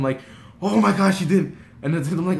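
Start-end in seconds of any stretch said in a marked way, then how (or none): 0.6-1.6 loud, high exclamation of relief; huge relief. Retold or imitated. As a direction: let the relief burst out. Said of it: "the most relieved yet the most sad".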